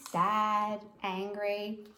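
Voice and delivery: ordinary delivery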